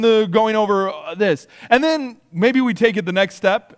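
ordinary delivery

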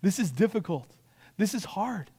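soft, understanding reassurance; caring. Acted. Parent hearing a troubled kid, soft and caring. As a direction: drop the volume and sympathise. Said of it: "You wanna care and go".

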